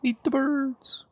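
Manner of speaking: high, drawn-out mock urging; playful urging. Co-player eggs on the player to eat birds, playful high urging. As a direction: stretch it out in a high put-on voice.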